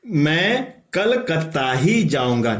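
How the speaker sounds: ही pressed hard and falling; stress on ही. Shown as a demonstration. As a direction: lean on the word ही to mean only Calcutta. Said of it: "यहां पर अवधारण कर दिया". (ही पर ज़ोर देकर)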